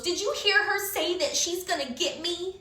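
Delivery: high, put-on tattling voice; whiny tattletale imitation; mocking whine. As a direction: mimic a petty snitch. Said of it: "little idiot turns around".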